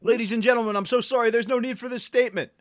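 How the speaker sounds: mock announcer. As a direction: cut in like a stage host counting in the band. Cuts Frank off like a host.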